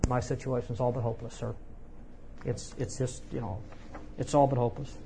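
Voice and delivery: lightly acted despondency, trailing into quiet; hopeless. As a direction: answer as someone who has given up. Acts the man's hopeless answer.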